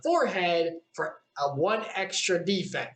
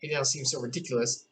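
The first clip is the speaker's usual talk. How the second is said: plain reading voice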